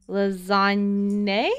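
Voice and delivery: lasagna drawn out as "la-zahn-AY", questioning; odd pronunciation. Shown as a demonstration. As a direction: stretch lasagna slowly, ending on a rising "ay". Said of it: "spell lasagna like that".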